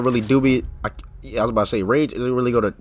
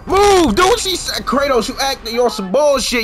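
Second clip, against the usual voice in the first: frustrated yelling; 9 semitones higher, slower than usual. Real, not acted. Gamer screams at Kratos to move, desperate yelling.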